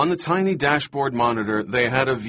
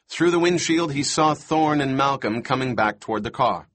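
usual speaking voice

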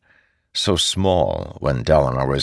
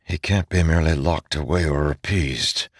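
plain reading voice

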